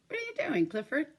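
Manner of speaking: high surprised call to the dog. Pretend. Calling to the dog again.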